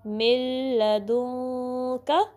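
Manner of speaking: मिल held on a clear l, nasal hum before का; stretched joined recitation of मिल to का; merging and hiding. Shown as a demonstration. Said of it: "नाक में आवाज़ नहीं ले जानी".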